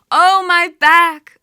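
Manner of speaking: high, loud grief-stricken wail, drawn out; wailing cry. Acted. Goddess in the underworld wailing for her husband, loud keening wail. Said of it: "she's crying out".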